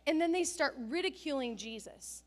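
ordinary talk

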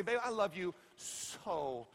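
slow, soft and affectionate; tender to wife. Retold or imitated. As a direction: say it gently and slowly to your wife. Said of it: "this is what I say".

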